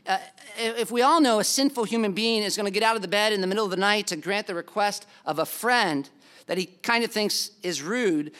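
ordinary talk, nothing marked

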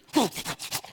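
acted gag and sputtering spit; mock spitting to clear a rancid taste off the tongue; imitated spitting. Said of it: "I'm trying to get it off my tongue".

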